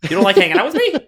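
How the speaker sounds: lightly played guilt-trip swelling to a wounded plea; Italian mom. Host playing an Italian mom guilt-tripping a friend, rising hurt. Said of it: "the Italian mom in me".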